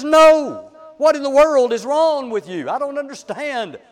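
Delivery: loud, high outburst of exasperation; infuriated. Retold or imitated. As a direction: act out losing it, loud and high. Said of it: "he's so infuriated".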